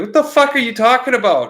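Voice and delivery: high, loud, exasperated demand; groggy dad snapping in disbelief; irritated dad. Retold or imitated. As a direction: snap at the kid who woke you. Dad woken, confused and irritated.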